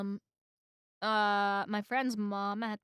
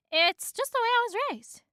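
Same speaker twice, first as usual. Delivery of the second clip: earnest bee; 11 semitones higher, 4 dB louder, faster than usual. A narrator's reading. Bee explains himself earnestly.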